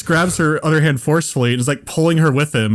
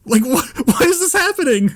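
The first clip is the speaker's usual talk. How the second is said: high-pitched, flustered retelling; bewildered protest; 12 semitones higher than usual. Retold or imitated. Confused bystander protesting to angry mother, high flustered voice. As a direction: protest in baffled panic, half laughing.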